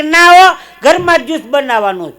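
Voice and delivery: high, very loud objection; loud refusal. Acted. Wife refusing her husband's idea of shop juice, loud and insistent. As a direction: refuse flatly, voice shooting up.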